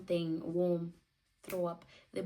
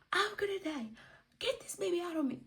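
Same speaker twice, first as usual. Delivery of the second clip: retold scream; 11 semitones higher, faster than usual. Mother in labour begging for delivery, mock screaming. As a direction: imitate a desperate labour scream. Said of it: "I started screaming".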